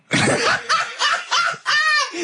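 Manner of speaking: high squealing laugh; long, high, uncontrolled cackle; helpless laughter. Real, not acted. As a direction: burst out laughing at the absurdity. Cracking up at licking toads.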